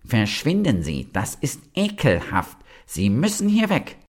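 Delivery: rising into a high, spiteful cry; venomous scolding. A narrator's reading. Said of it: "nahm all sein Gift zusammen und sagte".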